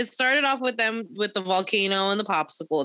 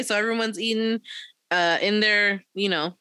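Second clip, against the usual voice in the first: normal talking voice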